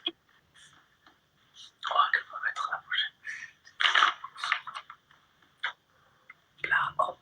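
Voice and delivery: hushed aside under the breath; whispering. Real, not acted. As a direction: mutter quietly to yourself. Whispering while setting up the call.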